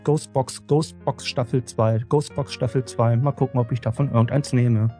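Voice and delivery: ordinary talk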